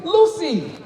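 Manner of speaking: mock parent yelling for a child; calling out. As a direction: holler the kid's name across the yard, high and loud.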